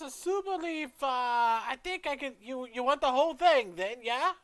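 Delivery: high, loud, hesitant character voice; forgetful shopkeeper. Acted. Shopkeeper offering a customer the whole bag, high and loud. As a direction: think aloud in a high-pitched granny voice. Shopkeeper haggles over leaf.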